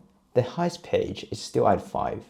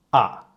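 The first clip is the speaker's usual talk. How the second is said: short, firm "ah" dropping sharply in pitch; falling tone; 3 semitones higher, 7 dB louder than usual. Shown as a demonstration. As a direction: drop "ah" all the way down. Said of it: "it goes all the way down".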